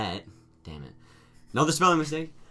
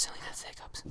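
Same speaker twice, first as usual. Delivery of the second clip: whispering; 15 dB quieter than usual. Real, not acted. Aside to audio listeners.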